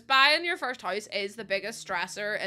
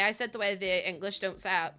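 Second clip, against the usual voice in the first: usual speaking voice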